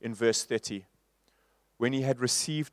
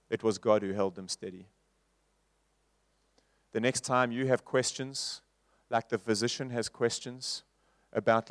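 hushed preaching; 2 semitones lower, 4 dB quieter than usual. Acted. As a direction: drop to an intimate hush. Softer reflective sermon passage.

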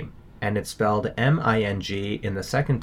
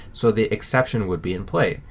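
ordinary talk

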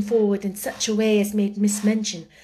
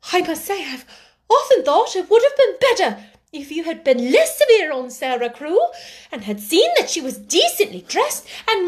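higher, emphatic pleading voice; earnest, high-pitched remonstrance; timid sister's reproach; 8 semitones higher, 5 dB louder than usual. A narrator's reading. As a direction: voice a meek woman working up her nerve.

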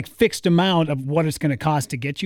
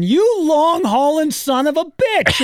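slow higher mock accusation; mock angry yell; 9 semitones higher, slower than usual. Buddy in the back seat yelling at the cabbie, mock angry. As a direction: drag it out, half-hearted yell. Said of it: "my buddy Mark was in the back seat going".

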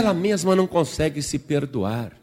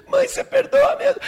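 put-on high, loud childish wail; hammed-up sobbing plea; tearful, anxious little girl; 6 dB louder than usual. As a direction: beg forgiveness like a crying child. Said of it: "a menina tava super nervosa".

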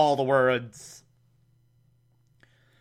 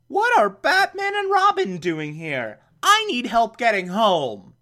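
put-on higher, fussy old-lady voice easing down; Aunt Harriet voice; 7 semitones higher than usual. Podcaster voicing Aunt Harriet asking for help, higher old-lady voice. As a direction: chatter it like a flustered aunt.

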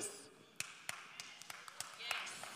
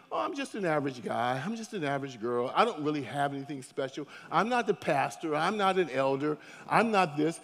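lower, softer, mopey self-doubt; self-pitying voice; 7 semitones lower, 5 dB quieter than usual. Retold or imitated. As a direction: sigh it out like you feel ordinary. Imitating self-doubting churchgoer.

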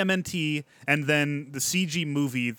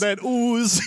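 goofy turtle voice; 7 semitones higher, 4 dB louder than usual. Pretend. Echoing the joke voice.

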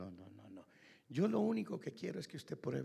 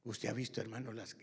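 ordinary delivery

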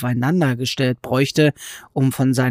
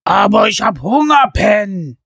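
loud, high whine of a hungry giant; whining giant; 7 semitones higher, 7 dB louder than usual. A narrator's reading. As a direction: beg like a child at the zoo. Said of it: "jammerte der erste Riese".

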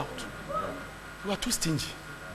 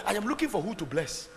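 ordinary talk

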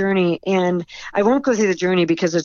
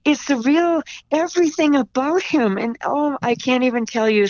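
tearful awe; 7 semitones higher than usual. Real, not acted. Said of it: "oh, I'm gonna cry".

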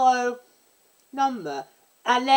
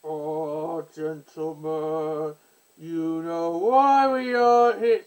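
slow, lower try at a deep bass; slow mock bass voice, barely lower; attempted bass voice; 4 semitones lower, much slower than usual. Shown as a demonstration. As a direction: reach for a deep villain voice and miss. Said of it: "I'll do Cass's first line".

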